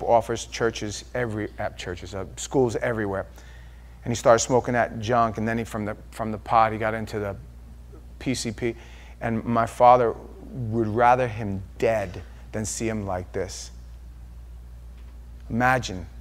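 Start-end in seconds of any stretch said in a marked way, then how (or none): none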